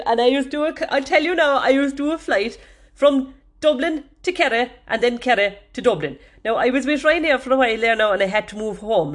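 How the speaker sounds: put-on high, loud Kerry accent. Telling lads about flying down to Kerry, Kerry accent. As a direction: slip into a full Kerry accent, pitched well up. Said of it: "still talking now in the Kerry accent".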